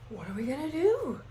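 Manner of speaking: high, puzzled disciples asking for help; confused disciples. Retold or imitated. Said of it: "And the disciples are like".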